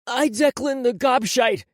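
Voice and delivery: put-on loud, high, slow boy's voice; tearful repeating. A narrator's reading. Crying boy repeats the forced apology, tearful. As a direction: repeat it unwillingly through tears. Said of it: "Declan started to cry".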